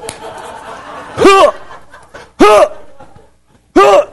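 loud, high yelped "huh"; short explosive "huh" bursts; sharp high "huh". Retold or imitated. Preacher acting out the march, high yelped "huh". Mimics grunts while entering armed gates.

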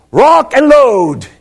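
high, loud, drawn-out war cry; rallying shout. Retold or imitated. Preacher rallying the guys before going in, long high shout. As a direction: holler it long to rouse the team.

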